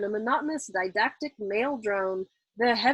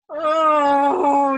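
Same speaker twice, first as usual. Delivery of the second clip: a loud, exasperated moan that slips out; 5 semitones higher, 10 dB louder than usual. A narrator's reading. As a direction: groan out loud in frustration. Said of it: "Nately moaned out in exasperation".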